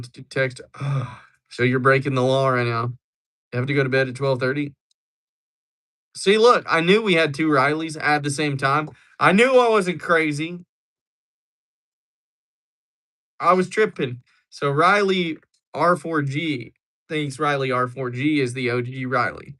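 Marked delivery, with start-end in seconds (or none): 6.2-14.2 excited vindication. Real, not acted.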